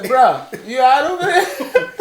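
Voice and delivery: mock friend call. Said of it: "he said".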